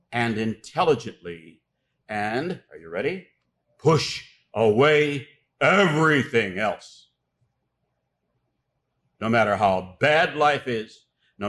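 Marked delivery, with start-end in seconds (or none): 3.8-7.1 slow, loud, every word punched; emphatic command. Real, not acted. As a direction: drive each word home forcefully and slowly. Preacher's emphatic climax after "are you ready.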